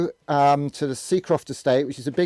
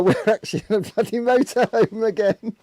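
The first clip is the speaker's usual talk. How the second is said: laughing; 8 semitones higher than usual. Real, not acted. Laughing about the motorhome again.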